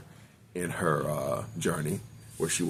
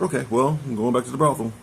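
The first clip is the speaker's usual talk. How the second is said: mom voice; 6 semitones higher, 6 dB louder, faster than usual. Retold or imitated. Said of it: "Mom was like".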